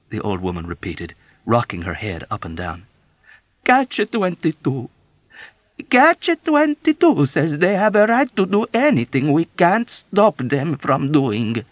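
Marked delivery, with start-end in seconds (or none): 3.6-11.6 very slow, high, loud wail. Acted. Old woman wailing to Yossarian, slow high lament. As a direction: stretch it into a mournful wail.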